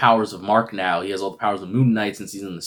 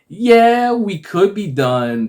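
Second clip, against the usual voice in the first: high, drawn-out, loud start; slow, exaggerated taunt; mock agreement; 12 semitones higher, 8 dB louder, much slower than usual. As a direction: agree teasingly with a catch coming. Acted Khonshu stringing Mark along.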